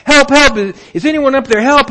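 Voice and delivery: high, loud cries for help from a cliff; desperate yelling for help. Acted. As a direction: shout it up the canyon, panicked. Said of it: "Jack began yelling for help".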